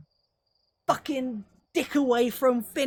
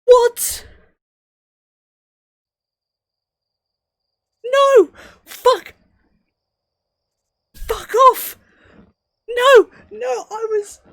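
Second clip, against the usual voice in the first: high, loud yelps of fury; real despair boiling into yells; anguished shouting; 12 dB louder, slower than usual. Real, not acted. Player yelling at lost progress, anguished shouts.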